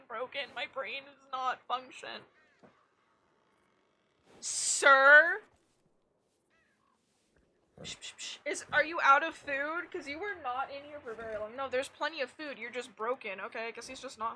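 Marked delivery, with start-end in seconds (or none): 4.4-9.9 louder, higher call to the cat; questioning call out; calling pet. Real, not acted. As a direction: get the cat's attention.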